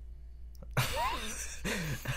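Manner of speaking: high, breathless laughing fit. Real, not acted. Losing the silly argument with co-host, laughing. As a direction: crack up helplessly. Cracks up after botched word argument.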